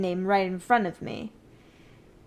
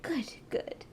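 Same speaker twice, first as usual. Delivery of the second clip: whisper; 3 semitones higher, 8 dB quieter than usual. Acted. Pleased with servant's answer.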